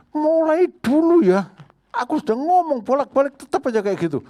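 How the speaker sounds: high, loud mock complaint of someone still angry; hammed-up whiny grudge voice; grumbling grudge holder. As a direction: play a nagging resentful person.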